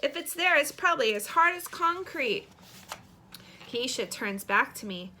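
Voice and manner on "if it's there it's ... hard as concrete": put-on excited boy. A narrator's reading. Boy digging through desk for cookies.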